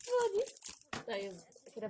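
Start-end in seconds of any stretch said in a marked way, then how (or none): 0.1-0.4 shocked squeal. Real, not acted. Empty bag, shocked squeal.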